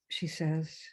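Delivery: ordinary delivery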